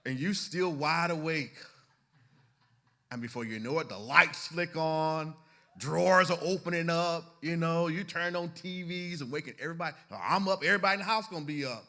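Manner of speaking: loud, high, mounting exasperation; hammed-up shouting, pitched high; worked-up comic rant. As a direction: act out waking the whole house in a huff. Dramatizes sleepless spouse waking the house.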